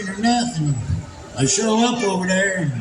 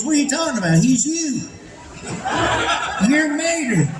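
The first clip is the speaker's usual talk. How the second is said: higher-pitched mock disbelief; retold incredulous reply; 5 semitones higher than usual. Director answers the nervous voice actor, incredulous. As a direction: act baffled, pitch lifting as you go.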